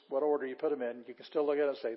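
ordinary talk

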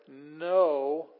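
"No" loud and drawn out; emphatic NO. Shown as a demonstration. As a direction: hit "No" hard, then pause. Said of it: "Emphasis by putting it at the front".

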